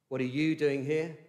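YOU punched and lifted; stress on YOU. Shown as a demonstration. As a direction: point the question at you. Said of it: "where the emphasis you could put".

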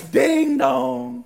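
loud sing-out doorbell chime; mimicked two-note doorbell; doorbell imitation.

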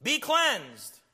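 high ringing command; commanding Jesus. Retold or imitated. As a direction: proclaim it like a healer from afar. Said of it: "Jesus could have spoke".

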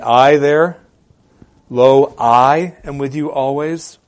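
slow and loud, hitting "I" and "Lo"; emphatic quote. Shown as a demonstration. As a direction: press each word of "Lo, I am with you always". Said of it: "it's very emphatic in the Greek".

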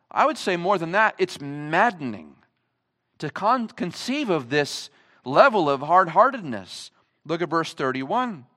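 normal talking voice

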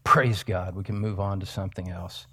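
quick near-whisper; hushed aside. Pretend. Preacher relieved the point is established, hushed aside. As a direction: toss it off very softly under the breath.

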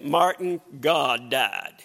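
low, grave drop on the last words; lightly voiced wife breaking grave news; solemn wife. Luther's wife in mourning tells him grim news, solemn.

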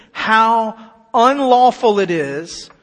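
"how unlawful" slow, loud, stretched out; emphatic reading. Shown as a demonstration. Preacher flags Peter's words for listeners, "how unlawful" hammered slowly. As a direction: lean hard on "unlawful". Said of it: "listen to this".

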